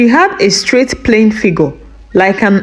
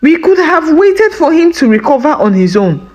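high-pitched exasperated scolding; fuming; 8 semitones higher than usual. A narrator's reading. Wife blaming husband for playing the hero, fuming. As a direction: berate him, pitch held high. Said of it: "Mma fumed".

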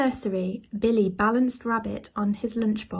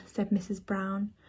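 usual speaking voice

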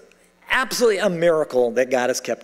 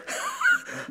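a quick high burst of laughter; laughing at his own bitterness mid-story; short high laugh; 6 dB quieter than usual. Real, not acted. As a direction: laugh at yourself.

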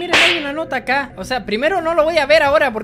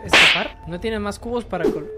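ordinary delivery

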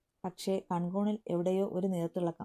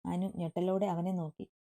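plain reading voice

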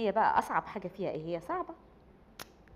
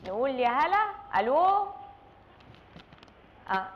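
playful TV host; 5 semitones higher than usual. Pretend. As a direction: play a call-in host with mock cheer. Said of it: "mock TV host taking call".

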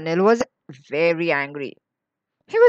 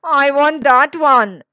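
put-on slow, raised, insistent child's demand; loud demand; 4 semitones higher, 6 dB louder, slower than usual. A narrator's reading. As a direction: say it loudly, stretching the words. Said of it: "said Anil loudly".

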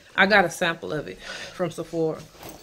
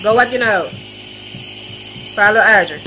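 calling out; 3 semitones higher, 8 dB louder than usual. Real, not acted. Mother calling to kids.

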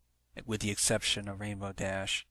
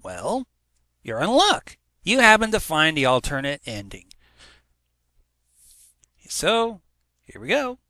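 loud, high, eager host voice; hyped intro; 7 semitones higher, 10 dB louder than usual. Acted. Host teasing listeners about the alternate ending, loud and eager. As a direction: sell the secret ending to the audience.

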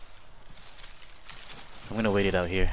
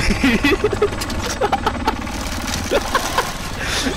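high shrieking laugh; hysterical laughter; 4 dB louder than usual. Real, not acted. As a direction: cackle with pure joy. Laughing after the win.

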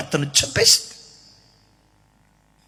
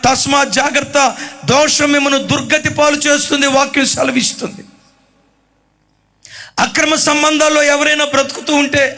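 fiery preaching; 5 semitones higher than usual. Acted. Preacher warning the listening young people, fervent. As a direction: exhort them, rising in force.

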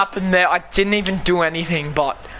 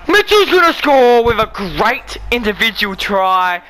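excited; 4 semitones higher, 5 dB louder than usual. Real, not acted. Gamer commentating his own rugby try.

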